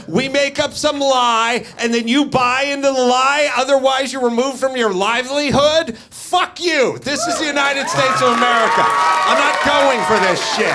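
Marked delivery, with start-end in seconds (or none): 6.0-10.8 rapid indignant outburst; defiant shout. Real, not acted. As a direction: shout it out in outrage. Angry rant about free opinion.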